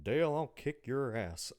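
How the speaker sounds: a hint of a gruff Texan dad; lightly voiced Hank Hill threat. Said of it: "You are way too good at that voice".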